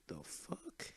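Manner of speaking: hushed, high-pitched incredulity; soft, high mock-shocked question; retold disbelief. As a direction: quietly squeak it in disbelief. Retold shock at seeing the hug.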